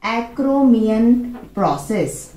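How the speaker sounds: acromion drawn out very slowly; slow term. Shown as a demonstration. Teacher introducing a new term to students, very slow. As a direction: stretch acromion syllable by syllable so students catch it. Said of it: "teacher spells out a new term".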